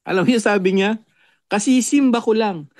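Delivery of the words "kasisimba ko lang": retold boast. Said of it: "pinagmalaki pa".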